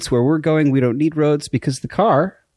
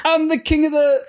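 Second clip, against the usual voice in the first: hammed-up movie line; 13 semitones higher than usual. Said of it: "doing a thing with my arms".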